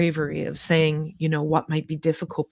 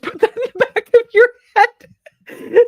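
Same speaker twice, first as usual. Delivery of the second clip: high, loud laughing burst through the words; 9 dB louder than usual. Real, not acted. Laughing to the chat about outliving her dog. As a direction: laugh it off as you say it.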